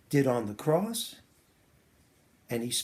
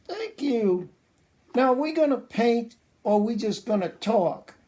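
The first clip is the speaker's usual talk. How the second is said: puppet voice; 8 semitones higher, 3 dB louder than usual. Acted. Puppet Larry.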